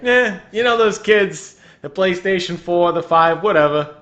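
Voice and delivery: loud, dismissive bum voice; mock a cheap knockoff hero shrugging it off; Hobo Iron Man. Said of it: "You'd be like Hobo Iron Man".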